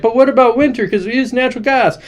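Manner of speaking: high-pitched mock fretting; mock worried crowd. Everybody objecting to the president's plan, mock worried. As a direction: raise it high like anxious objectors. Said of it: "everybody's like".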